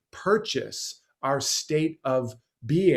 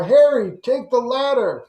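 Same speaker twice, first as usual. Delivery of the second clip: higher and louder, shouting down from above; calling rescuer; 10 semitones higher, 8 dB louder than usual. Retold or imitated. Helicopter rescuer shouting to Harry on the roof, loud high call. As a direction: yell it over a helicopter.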